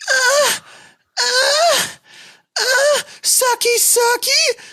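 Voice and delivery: high mock wailing "ah, sucky"; mocking kids' wailing. Imitating the teens' wailing in the locker room shower. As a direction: wail it high like taunting teens. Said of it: "they're, like, going".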